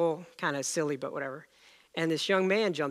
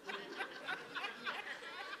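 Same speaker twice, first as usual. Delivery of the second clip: burst of laughter; 15 dB quieter than usual. Real, not acted. Laughing at own punchline.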